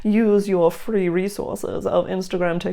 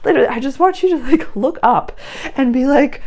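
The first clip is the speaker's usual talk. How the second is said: excited; 9 semitones higher, 5 dB louder than usual. Real, not acted. Excited appeal to listener.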